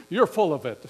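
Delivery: loud acted-out angry dismissal; retold furious comeback; angry retort. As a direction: throw it out like an insult, loudly. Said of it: "very angry, just immediately angry".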